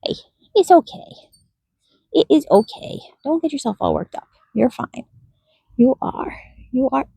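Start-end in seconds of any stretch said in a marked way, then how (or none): none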